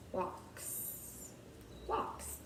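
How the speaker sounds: WALKS said softly, the final "ks" stressed; soft WALKS with the ending sounds picked out; sounding out. Shown as a demonstration. Teacher listening for sounds in walks, softly sounding it out.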